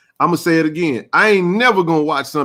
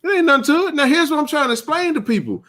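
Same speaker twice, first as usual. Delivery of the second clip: excited; 10 semitones higher than usual. Real, not acted. Excited high argument.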